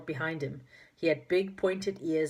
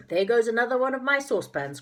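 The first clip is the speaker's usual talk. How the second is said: groaning; 8 semitones higher, 6 dB louder than usual. A narrator's reading. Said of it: "groaned Saucepan".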